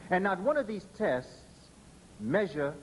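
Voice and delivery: ordinary talk, nothing marked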